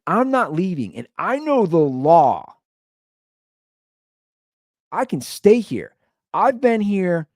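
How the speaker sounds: retold stubborn declaration; defiant roommate. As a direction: stand your ground, asserting your rights. Acts roommate defiantly claiming legal rights.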